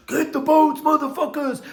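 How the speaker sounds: high, loud imitated drill scream; Goggins scream. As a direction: bark it like a hardcore trainer yelling at recruits. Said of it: "pay fucking Goggins to come in and scream at you".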